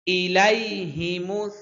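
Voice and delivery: ইলাইহিমুস drawn out slowly, each letter clear; slow recitation model. Shown as a demonstration. Teacher models the verse for the class, slow and careful. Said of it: "teacher models Quran recitation".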